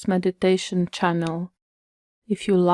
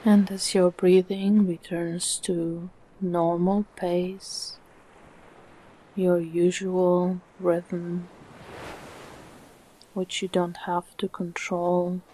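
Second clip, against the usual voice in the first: calm meditation voice with long, unhurried pauses; soothing, evenly paced relaxation guidance; guided meditation; 5 dB quieter than usual. Acted. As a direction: guide the listener gently into stillness, phrase by phrase.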